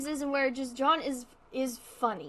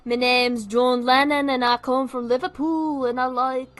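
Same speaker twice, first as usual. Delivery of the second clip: loud cartoonish Liverpool caricature; 8 dB louder than usual. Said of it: "There's so much more to [name] than just".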